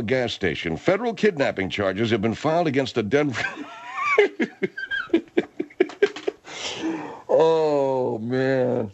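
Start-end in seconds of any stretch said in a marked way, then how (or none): 4.2-8.9 can't stop laughing; laughing fit. Real, not acted. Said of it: "what's wrong".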